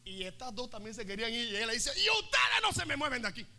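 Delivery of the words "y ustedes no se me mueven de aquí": commanding order. Acted. Magdalena orders the women.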